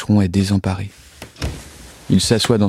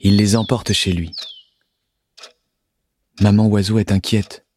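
usual speaking voice

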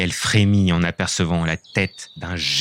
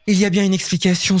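quick, high, resolute; a touch of stubborn resolve; determined girl; 10 semitones higher, faster than usual. Acted. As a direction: Lison telling herself she'll find out. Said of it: "déterminée".

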